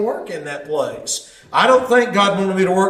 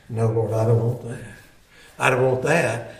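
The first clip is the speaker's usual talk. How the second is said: humble prayer; 7 semitones lower, 4 dB quieter than usual. Retold or imitated. Answering the Holy Spirit, humbled.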